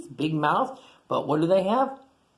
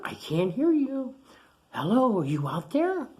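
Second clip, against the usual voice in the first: mock calling out; 8 semitones higher than usual. Teacher calls out to class.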